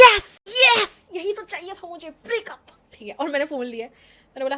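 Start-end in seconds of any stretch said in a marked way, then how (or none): 0.0-2.6 high, slow drawn-out yes yes, triumphant; gloating yes. Retold or imitated. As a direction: savour the win, higher. Gloating as friend breaks up.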